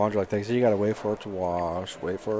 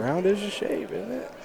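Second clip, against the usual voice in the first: mock incredulous; 11 semitones higher than usual. Real, not acted. Mock echo of teasing friend.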